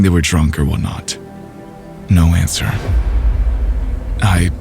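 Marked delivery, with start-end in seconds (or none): none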